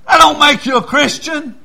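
high, loud put-down; emphatic retort. Retold or imitated. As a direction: slap the claim down hard. Pastor's retort to woman.

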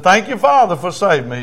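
high, loud burst of thanks; leading a prayer. Retold or imitated. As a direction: lift the voice to the Father. Preacher leads thanks for repeating.

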